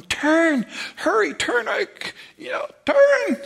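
high frantic shouts at the helm, voice cracking; retold panic, screamed high and urgent; panicked yelling. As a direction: yell the turn like the boat's about to go over. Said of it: "I'd be driving there going".

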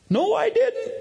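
put-on very high insistent kid voice; imitated child's denial. Son contradicting dad, high mock child voice. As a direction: deny it like a protesting boy.

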